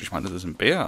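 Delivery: ordinary talk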